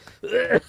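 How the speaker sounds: high strained choking cough. Real, not acted. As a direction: cough it out hard. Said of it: "I'm choking on my coffee".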